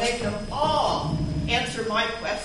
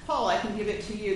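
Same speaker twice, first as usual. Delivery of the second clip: ordinary delivery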